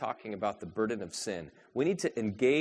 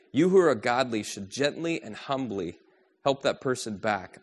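louder, earnest scripture read; reading Galatians aloud to the church, firm and raised; 2 semitones higher, 5 dB louder than usual. Acted. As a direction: proclaim the verses to the congregation.

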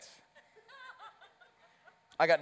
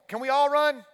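put-on high, loud childlike question; eager kids; 10 semitones higher, 7 dB louder than usual. First graders begging coach to run, high and loud. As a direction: imitate little kids clamoring to join.

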